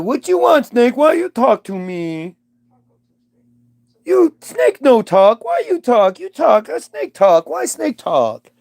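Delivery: high, broken caveman talk, halting; dim cavewoman Eve. Retold or imitated. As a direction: play Eve as a simple-minded brute puzzled by a snake. Eve voiced as simple cavewoman.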